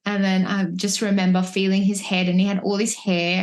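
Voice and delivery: ordinary talk, nothing marked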